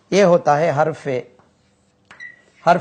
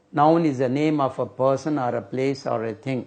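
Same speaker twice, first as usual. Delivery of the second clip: recited definition; much slower than usual. Shown as a demonstration. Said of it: "recites textbook definition".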